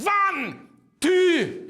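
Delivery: loud, high mock Scottish-German count; mixed Scottish-German count. Shown as a demonstration. Drummer showing a mixed accent count, loud hammed-up. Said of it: "So you can have".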